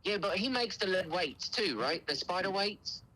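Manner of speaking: high put-on British questioning; British accent. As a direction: prank customer in a fake British accent. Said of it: "the best British accent I've ever heard".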